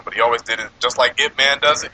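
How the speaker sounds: ordinary talk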